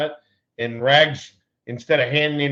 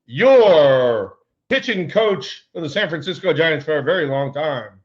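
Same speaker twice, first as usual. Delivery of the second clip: mock announcer intro; 4 semitones higher, 4 dB louder than usual. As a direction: present him like a ballpark announcer. Introduces pitching coach on card.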